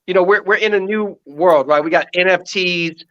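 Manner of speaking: ordinary delivery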